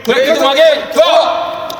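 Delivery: loud echoed safety call; shouted check call. Real, not acted. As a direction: bark back the checklist item.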